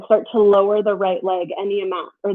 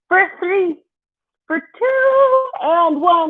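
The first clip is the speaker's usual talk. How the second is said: rising excited countdown; 8 semitones higher, much slower than usual. Real, not acted. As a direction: pitch climbing on each count. Countdown climbing in pitch.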